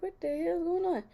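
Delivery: high, loud mock exasperation; hammed-up cry of pained bewilderment; exasperated outcry. Vlogger retelling her reaction to cramps in bed, mock exasperated outcry.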